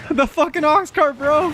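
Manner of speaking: yelling in shock; shocked outburst. Real, not acted. Ox cart knocks him off.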